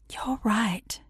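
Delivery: whispered, soft and gentle; softly. Acted. Etta May agreeing gently with her crying friend, whispered. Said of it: "she said softly".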